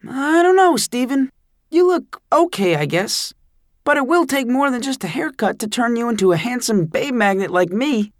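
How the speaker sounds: put-on high, child's voice; a small kid's solemn verdict, pitched high; solemn little brother. A narrator's reading. A little brother solemnly judging his bald sibling, high child voice. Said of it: "he said very solemnly".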